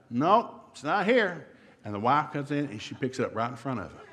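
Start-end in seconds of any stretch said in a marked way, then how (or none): 0.1-1.5 mock insistent husband, higher; insisting husband. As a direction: stubbornly insist it isn't there.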